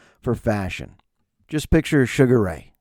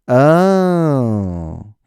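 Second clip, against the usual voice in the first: bored employee; 2 semitones higher, 7 dB louder than usual. Retold or imitated. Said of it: "most miserable human".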